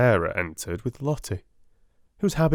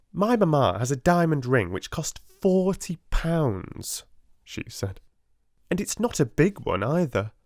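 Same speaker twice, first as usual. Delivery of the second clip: haughty schoolgirl, pitched up; spiteful Lavinia; 8 semitones higher than usual. Acted. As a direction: sneer it like a spoiled rich girl. Lavinia voiced in story.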